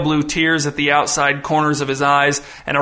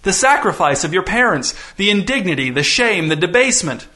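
higher, relishing theatrical proclamation; giddy; 4 semitones higher than usual. Acted. Visitor lectures the daughters on their parents' sacrifice, giddy. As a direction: savor each item with mounting glee. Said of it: "He was well past giddy now".

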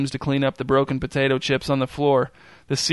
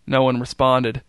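ordinary delivery

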